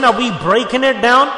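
ordinary talk

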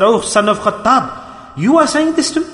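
drawn-out address, then a very high questioning challenge; retold stern disbelief, climbing steeply at the end; stern rebuke. The Prophet sits up to challenge Umar, retold stern rebuke. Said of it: "said sternly".

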